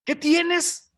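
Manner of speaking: a wife who demands an answer, high and a bit loud; sharp demanding wife. Retold or imitated. Jezabel presses her sulking husband, high impatient demand. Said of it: "Ya ves cómo son las mujeres muy amables para preguntar".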